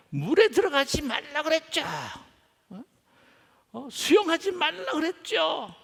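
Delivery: high, loud yell from a distance; scolding shout. Acted. Lifeguard shouting at a drowning swimmer from shore, scolding yell. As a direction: holler it like an accusing telling-off. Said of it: "손가락질하면서 막 소리를 질러요".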